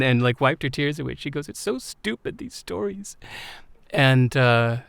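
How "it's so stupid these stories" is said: high, soft imitation of crying; retold tearful voice. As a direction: imitate a woman talking through tears, small and pitched up. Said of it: "this time she started crying".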